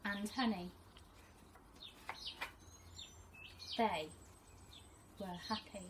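teacher reading to her class, gentle and expressive; storybook reading. Acted. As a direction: read it like a teacher sharing a picture book with her class. Reads picture book to pupils.

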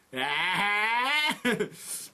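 high, drawn-out cry of alarm; mock scream on being caught; startled yelp. The two caught hugging by the nurse, startled yelp.

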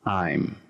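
"I'm" as one single syllable; "I'm" in one smooth falling syllable; contracted form. Shown as a demonstration. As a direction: run it together as one word, "I'm". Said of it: "es simplemente".

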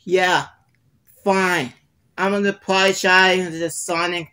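sulky reluctant apology; grudging. Acted.

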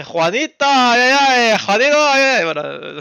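high, loud hammed-up Spanish voice; mock Spanish. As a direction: ham up a Spanish caricature, aïe aïe. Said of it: "l'espagnol, c'est".

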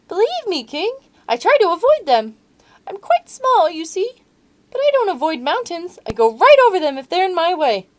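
very high, loud little mouse squeak; frightened mouse pleading with the lion, squeaky. Acted. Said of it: "mouse speaks".

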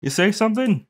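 loud deafened call. Acted. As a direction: shout it like your ears are plugged. Can't hear through earplugs.

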